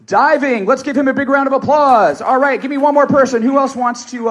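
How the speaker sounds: loud, high call for applause; hyped-up hosting. Real, not acted. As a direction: whip the audience into clapping. Hyping applause from the crowd.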